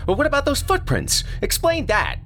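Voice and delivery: put-on high, pushy boy demanding; a boy daring his friend; challenging kid voice. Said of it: "challenging his assertions".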